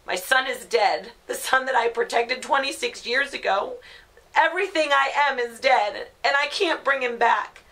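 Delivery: grief building into loud, high-pitched fury; anguished angry outburst. Acted. Grieving mother telling her family to leave her alone, furious. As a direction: start firm, rise to a raw outburst.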